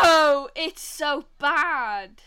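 loud, slow, drawn-out complaint; exasperated. Real, not acted. Telling Joe the accent is awful, loudly exasperated. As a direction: raise the pitch and stretch every word.

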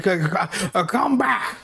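hammed-up furious come back; mad hermit yell. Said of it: "starts screaming at him to come back, mad as hell".

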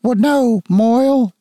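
can't bring himself to say it; hesitant father. Acted. Father breaking news about the mother to his son, high and halting.

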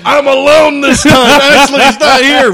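high, loud, giddy mock of a friend sneaking a pitcher; gloating high and loud like a kid let loose; gleeful buddy. Mocking his friend who drank with no one watching, high and giddy. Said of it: "He's like".

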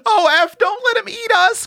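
very high, squealing plea; high-pitched childish panic; little girls crying out. A narrator's reading. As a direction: plead shrilly like a terrified little girl. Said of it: "cried both little girls".

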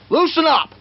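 high-pitched mock yell; retold warning. Opponent warns him in the hold, mock yell.

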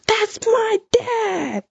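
put-on high, slow, proud child's exclamation; proud little kid. Young daughter proudly pointing out her dad at the ceremony, high and drawn out.

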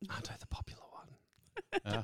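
hushed whisper. Real, not acted. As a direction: whisper it under your breath. Whispered aside.

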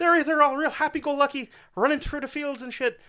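high, happy-go-lucky leprechaun Irish; Lucky Charms Irish. Acted. Host mocking bad Irish accents, high leprechaun Irish. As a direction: pitch it way up in mock cheery Irish. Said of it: "It's either Lucky Charms leprechaun".

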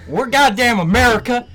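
loud, high, hammed-up American patriot rant; mock patriotic rant to the enemy, loud and high; mock patriotic anthem. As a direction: bellow it like a cartoon flag-waver. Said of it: "national anthem".

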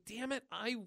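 a hint of a scared kid; frustrated girl.

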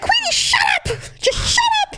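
yelling at the dog. Retold or imitated. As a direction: shriek at the dog to hush, half joking. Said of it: "And I'd be like".

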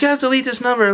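very high put-on voice; disguised voice. Putting off unwanted callers, high disguised voice. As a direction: pitch it way up to hide who you are. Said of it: "I would change my voice".

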